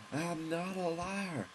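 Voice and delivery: slow, high, hammed-up proclamation of honor; mock heroic declaration. Gamer vowing to fight the dojo, a hammed-up slow high vow. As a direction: stretch it out high like a grand vow. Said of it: "And I'm not a liar".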